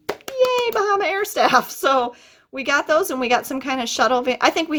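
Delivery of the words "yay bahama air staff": high, wry little yay; mock cheer. Real, not acted. Speaker thanks the airline staff with a wry high cheer. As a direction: cheer them with a wink.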